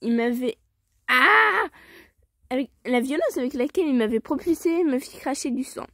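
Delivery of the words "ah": loud, high yell of frustration; high frustrated "aah"; exasperated scream. Real, not acted. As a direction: scream out at your own stumbling. Exasperated at stumbling over the line.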